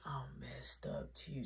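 slow soft whisper. Retold or imitated. Junior answering from the couch, slow whisper. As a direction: mumble it out, wrecked. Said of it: "Junior like".

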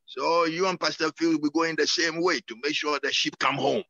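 ordinary talk

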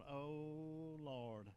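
long drawn-out "Oh", savoring it; slow, swooning mock moan of pleasure; savoring delight. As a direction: imitate blissful relish at a first bite. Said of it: "It was so good".

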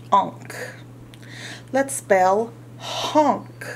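ordinary delivery

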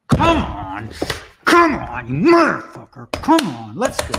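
loud, pitch-leaping enraged shouts; real exploding frustration; furious yelling. Real, not acted. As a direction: scream at the frozen game to move. Plea escalates into yelling.

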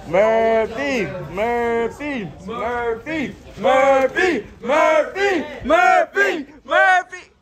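building from loud call to full shout; yelling name. Real, not acted. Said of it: "this man got so loud".

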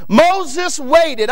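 normal talking voice

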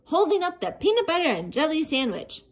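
ordinary delivery

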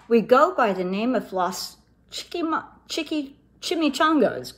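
plain reading voice

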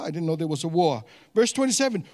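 ordinary talk, nothing marked

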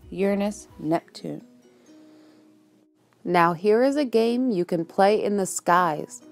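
normal talking voice

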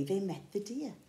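ordinary delivery

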